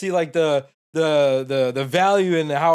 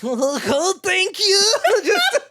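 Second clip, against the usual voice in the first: very high, giddy mock gratitude; flustered boy; much slower than usual. Boy thanking a pretty girl, high flustered squeal. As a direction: imitate a boy overwhelmed by attention, laughing.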